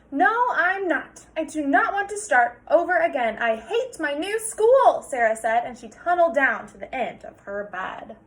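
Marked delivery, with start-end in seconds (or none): 0.1-5.0 higher, louder whiny refusal; insist, dragging the words; protesting child. Acted. Sarah arguing with her father, higher and whiny.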